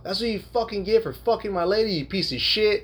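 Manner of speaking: retold shout at a rival. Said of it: "he's shouting now".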